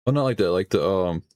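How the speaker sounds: normal talking voice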